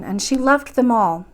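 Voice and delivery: plain reading voice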